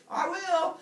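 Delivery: high, loud, cartoonish reply; eager husband. Heracles answering his wife as he leaves, loud and chirpy. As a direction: call back with comic eagerness.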